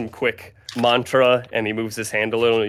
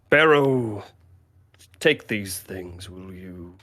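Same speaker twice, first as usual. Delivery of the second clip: slow creature voice; 3 semitones lower, slower than usual. Acted. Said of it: "And he goes".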